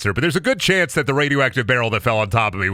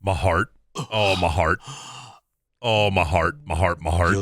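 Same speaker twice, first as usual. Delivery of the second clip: low, fading my heart; mock heart attack; 6 semitones lower than usual. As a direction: clutch your chest, hammed-up dying.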